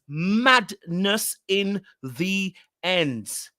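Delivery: very slow, every word stretched out; drawn-out emphasis. Shown as a demonstration. Vlogger repeating her catchphrase, slowed right down. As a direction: drag it out for effect.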